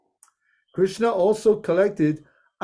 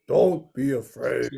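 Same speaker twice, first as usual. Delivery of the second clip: deep Krishna voice; 6 semitones lower than usual. Acted. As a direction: speak as Lord Krishna, low and calm. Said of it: "In a deep voice".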